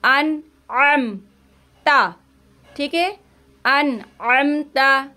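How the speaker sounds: slow, अम with the ain sounded from the throat; clear ain. Shown as a demonstration. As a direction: hold नून and ain in अन अम ता. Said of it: "इस तरह से आइन की आवाज़ आएगी". (अन अम ता में ऐन की आवाज़ साफ़)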